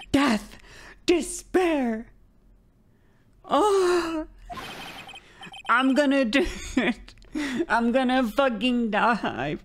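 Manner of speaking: high, hammed-up despairing wail; screaming client. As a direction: wail theatrically in mock agony. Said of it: "your client screaming over there".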